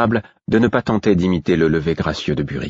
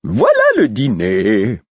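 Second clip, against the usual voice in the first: much higher, louder, slow and delighted; enthusiastic exclamation; 6 semitones higher, 4 dB louder, much slower than usual. Acted. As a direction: announce dinner with glee as the Fool. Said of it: "s'exclama le fou avec enthousiasme".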